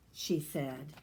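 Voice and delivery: usual speaking voice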